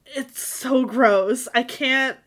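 slow, drawn-out disgust with stress on so. Real, not acted. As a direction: stretch out "so" in real disgust. Disgusted reaction to the story.

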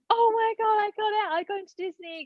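high squealed announcement, loud at the start; excited outburst. Retold or imitated. Telling her team she got into Disney, squealing with excitement. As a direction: re-enact bursting out with huge news. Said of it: "so excited".

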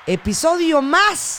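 high, slow drawn-out sign-off; announcer voice. Acted. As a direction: stretch it like a show host.

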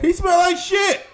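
very high, loud, slow; loud exclaim. Real, not acted. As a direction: shout it out, disgusted. Exclaiming friend stank badly.

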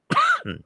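single chesty cough. Real, not acted. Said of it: "I'm coughing a little bit".

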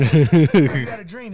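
slow, loud teasing line; mocking. Teen teasing crying dad, loud and slow. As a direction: stretch it out, ribbing dad. Martin Luther King joke.

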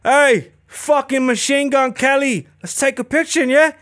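swaggering fighter hollering across the carpet; McGregor calling out. Retold or imitated. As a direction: call him over, cocky and loud. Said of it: "and he goes".

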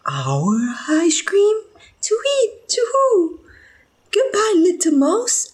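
high, loud owl voice rising in alarm. Acted. Owl hearing it may be eaten, high panicked voice. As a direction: squeal it as a startled storybook owl.

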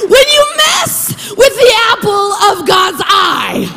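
high, drawn-out preacher's shout; thundering warning. Real, not acted. Preacher warning those who harm God's children, thundering. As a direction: stretch every word into a full-voiced cry of warning.

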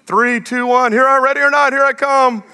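high sing-song hide-and-seek count; sing-song countdown. Retold or imitated. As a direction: call it out like a playful dad.

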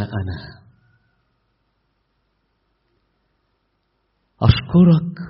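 earnest, loud prayer with long pauses; fervent prayer. Real, not acted. Preacher leading the congregation in prayer, fervent and loud. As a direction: pour out thanks to God, slow and fervent.

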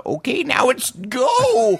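put-on high, slow, drawn-out old man voice. Imitating old man sending guest onstage, high drawn-out voice. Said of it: "the old man who was like".

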